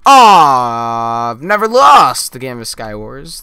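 long loud wail, then a shouted boast; outraged protest. Real, not acted. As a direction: cry out at the loss, very loud. Outcry at losing the game.

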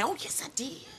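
high, soft innocent reply; innocent fake insistence. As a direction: sweetly play innocent.

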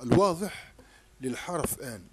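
normal talking voice

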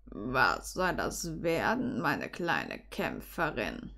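very low, slow, soft drawl; deep, quiet, drawn-out man's voice; teasing Severus. Acted. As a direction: ask it deep and unhurried. Severus questions her, eyebrow raised.

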